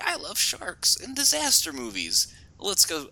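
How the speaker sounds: mock eager moviegoer. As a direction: sound naively eager. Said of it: "I'm like".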